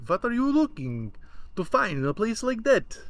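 high, loud Eastern European family; nagging Eastern European relatives; Eastern European accent. Retold or imitated. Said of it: "I don't do a good Eastern European accent or Russian accent".

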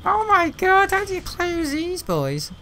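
mock high squeal. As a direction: squeal it in fake despair. Mock exasperated outburst.

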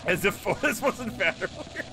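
high, helpless giggly speech; laughing through words. Real, not acted. Streamer mocking Otis's looks, laughing while talking. Cracking up at fat Otis.

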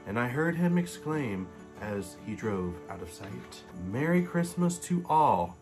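ordinary delivery

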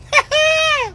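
a sudden high cackle; squealing laugh; high shrieking laugh. Real, not acted. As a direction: burst out in a shrill laugh. Shrill laugh.

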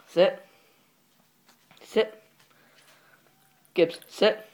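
loud, sharp dog commands; firm commands. Real, not acted. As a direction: order the dog to sit, loud. Repeating sit command to dog.

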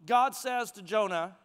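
ordinary talk

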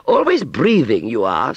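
high, loud scornful jibe; scoffing. Acted. Aunt Sally mocking Wurzel's breather, scoffing. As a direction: scoff at him, voice shooting up. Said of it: "scoffed Aunt Sally".

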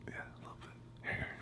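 soft whisper into the mic; barely-there ASMR whisper. Pretend.

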